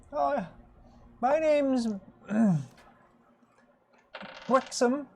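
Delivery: high-voiced old rabbit priest greeting survivors; old rabbit folk. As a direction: pitch way up for a doddery old rabbit.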